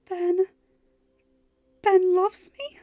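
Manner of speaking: high, loud disbelief; stunned gasp. A narrator's reading. Mal hearing Ben's confession, stunned and breathless. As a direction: catch your breath in shock. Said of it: "Mal gasped".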